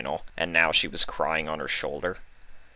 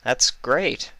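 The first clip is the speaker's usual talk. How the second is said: false smile; 5 semitones higher, much slower than usual. Acted. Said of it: "with a false smile".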